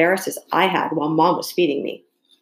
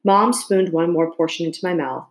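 plain reading voice

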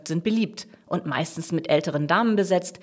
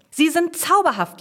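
high, loud whoop of joy; exultant, pitch leaping up; jubilant; 11 semitones higher, 6 dB louder than usual. A narrator's reading. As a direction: crow it in triumph. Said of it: "jauchzt Jasmin".

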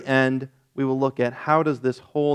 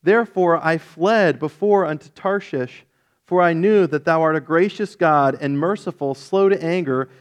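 raised, earnest Bible reading voice; scripture reading; 3 semitones higher, 3 dB louder than usual. Acted. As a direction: read the passage aloud with weight. Reads Jonah chapter aloud.

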